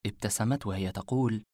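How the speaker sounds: usual speaking voice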